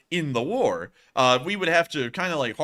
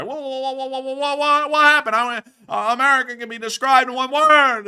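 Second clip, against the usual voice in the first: high, loud sputtering character voice; the other guy; 11 semitones higher, 8 dB louder than usual. Acted. Host switching characters mid-bit in a high frantic squawk. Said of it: "I'm about to turn into the other guy".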